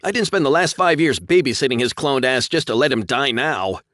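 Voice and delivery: high, loud heated rant; heated insisting. A narrator's reading. Pilot arguing with co-pilot, heated and loud. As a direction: push back hard on the co-pilot. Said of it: "Josh insisted".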